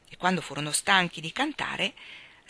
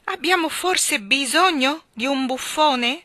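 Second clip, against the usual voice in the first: jester voice; 7 semitones higher, 7 dB louder, slower than usual. Acted. Jester's mocking question.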